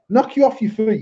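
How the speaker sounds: ordinary talk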